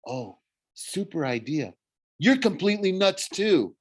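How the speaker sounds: high, incredulous retort; mock approval rising to high exasperation; sarcastic girl. Girl sarcastically rejects the plan.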